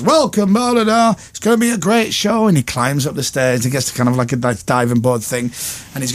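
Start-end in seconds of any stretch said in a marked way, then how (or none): 0.0-2.5 high, hyped presenter patter; Vernon Kay. Retold or imitated. Vernon introducing the skating show, presenting. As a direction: imitate a TV host opening a show. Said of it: "And he does".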